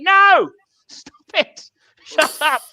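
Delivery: high, loud, drawn-out telling-off; exasperated scolding. Retold or imitated. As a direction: act out friends who are fed up. Acted Americans scolding his slang.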